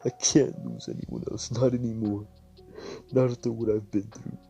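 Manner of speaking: weep and plead at once; a broken, whispery crying voice; sobbing plea. Acted. Crying over dead parents.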